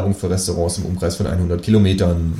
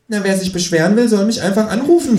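high cheeky kangaroo; 13 semitones higher than usual. Acted. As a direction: answer like the cocky kangaroo.

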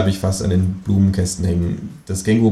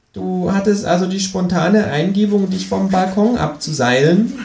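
a high, cheeky kangaroo asking; 12 semitones higher than usual. Acted. Kangaroo asks the narrator abseiling from the balcony, high and cheeky. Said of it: "fragt das Känguru".

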